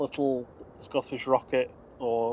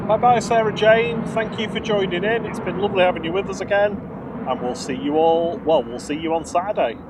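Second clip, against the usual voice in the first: cheerful sign-off; 6 semitones higher, 7 dB louder than usual. Real, not acted. Signing off the live stream.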